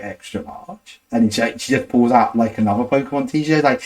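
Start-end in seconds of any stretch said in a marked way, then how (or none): 0.0-1.0 breathy whisper, no voice at all; whispered reply. Retold or imitated. Telling the clerk her size in a near whisper. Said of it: "And I'm like".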